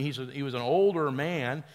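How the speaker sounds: ordinary talk, nothing marked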